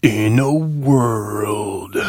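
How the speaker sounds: slow, dramatic trailer narrator; drawn-out epic voiceover; movie trailer guy. Retold or imitated. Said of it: "You can't forget movie guy".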